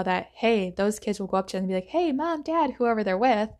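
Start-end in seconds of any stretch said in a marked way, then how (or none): 1.9-2.7 higher, lightly voiced pleading child; begging kid. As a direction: call up to a parent like a kid. Kids asking parents in store.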